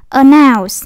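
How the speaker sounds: "Announce" with a loud, falling NOUNCE; stress on second syllable. Shown as a demonstration. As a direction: punch NOUNCE, keep the first syllable light. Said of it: "nó nhấn âm hai nè".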